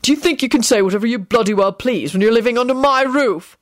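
barked out. A narrator's reading. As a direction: bark the question angrily. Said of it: "Quentin barked out".

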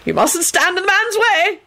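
high, fussy, demanding minister; Fudge. A narrator's reading. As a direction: insist in a high-pitched official's voice. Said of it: "he demanded".

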